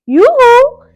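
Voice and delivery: very high, very loud hoot; loud yoo-hoo. Acted. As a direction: call out playfully to make him smile. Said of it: "in a loud voice".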